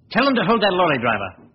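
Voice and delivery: sudden insistence; urgent excitement. Acted. Detective urges the inspector to hold the driver, excited.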